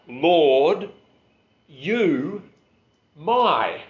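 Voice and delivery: "Lord" punched loud, then "you", "my" each set apart; emphatic Greek words. Shown as a demonstration. Preacher showing Greek word order, each word emphatic. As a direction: hit each word with pauses between. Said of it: "that's really emphatic".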